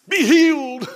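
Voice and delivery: slow, loud, high hammed-up healer cry; mock faith healer. Mocking Oral Roberts at a revival, hammed-up slow loud healing cry. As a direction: ham up a televangelist laying on hands.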